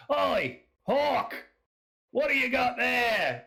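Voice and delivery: high, rowdy Australian call across a room; Australian accent. Acted. Drunk man calls to Hork across the room, Australian accent. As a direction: holler it like a boisterous Australian drinker. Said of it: "yes, that's my Australian accent".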